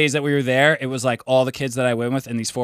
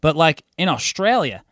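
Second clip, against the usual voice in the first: ordinary talk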